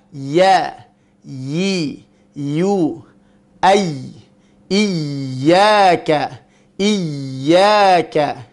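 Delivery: slow separate syllables, pressed YY in IYYAKA; consonantal ya demo. Shown as a demonstration. Teacher drilling voweled ya, slow deliberate syllables. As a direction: sound each ya carefully and deliberately. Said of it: "ya yang tidak dibaca panjang".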